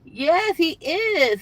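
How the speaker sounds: high, loud burst of agreement; excited yes. Real, not acted. Answering a viewer's comment, excited and loud. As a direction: jump in eagerly, laughing along.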